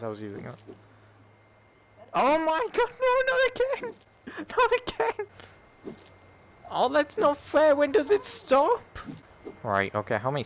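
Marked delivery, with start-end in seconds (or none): 2.1-5.3 very high-pitched real dismay; panicked outcry. Real, not acted. Player caught out again in the game, high panicked cry. 6.7-8.8 high-pitched wail of complaint; exasperated protest. Real, not acted. Player complaining that it's not fair, high exasperated cry.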